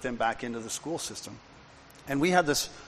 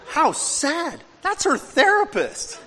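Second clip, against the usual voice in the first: exclaiming; 12 semitones higher, 7 dB louder than usual. Retold or imitated. Speaker's own retold exclamation.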